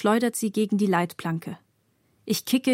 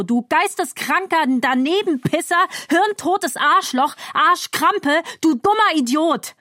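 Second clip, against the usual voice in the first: insults fired off, climbing higher and louder; ranting into rage; 5 semitones higher, 3 dB louder than usual. A narrator's reading. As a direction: build a breathless tirade of abuse. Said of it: "schreie mich doch in Rage". (sich steigernde, wütende Schimpftirade)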